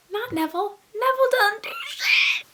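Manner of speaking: high-pitched indignant shout; exasperated high yell; indignant outburst. Real, not acted. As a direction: burst out in a high, outraged voice. Indignant outburst about Neville.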